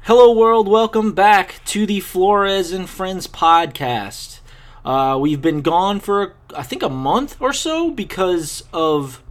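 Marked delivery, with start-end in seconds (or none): 0.0-4.4 podcast intro. Acted. Podcast host opening the show.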